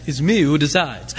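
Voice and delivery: light mock French accent, low and lazy; God as a cartoon Frenchman, only a hint of it; a touch of a Pepe Le Pew French accent. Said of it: "maybe God says it with a French accent".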